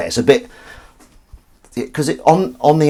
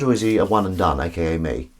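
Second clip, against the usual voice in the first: ordinary delivery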